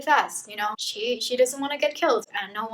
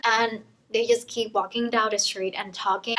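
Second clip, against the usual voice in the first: ordinary talk, nothing marked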